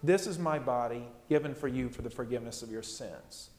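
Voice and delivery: low, soft, solemn recitation of the words of institution; hushed words of Jesus. Acted. Pastor quietly voicing Jesus at the supper, hushed and solemn. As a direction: lay the words down softly, like a prayer. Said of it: "on this night he says".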